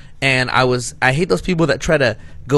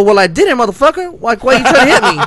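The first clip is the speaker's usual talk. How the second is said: higher, louder angry yell breaking into laughs; telling off a driver, laughing while yelling; mock angry yell; 9 semitones higher, 7 dB louder than usual. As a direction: shout it, half laughing. Retold road argument.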